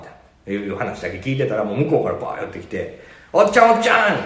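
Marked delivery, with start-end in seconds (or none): 3.3-4.2 put-on high, eager kid calling out; high-pitched child tugging for attention; imitating a child calling. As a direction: call him like an excited little kid. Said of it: "って言われて".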